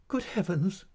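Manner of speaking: high-pitched distressed exclamation; crying out. A narrator's reading. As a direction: cry out in dismay. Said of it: "cried my mother".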